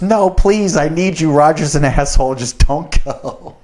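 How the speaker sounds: high, begging voice slowing at the end; mock plea to stay; pleading coworker. Coworker texting him not to quit, high mock pleading. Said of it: "she goes".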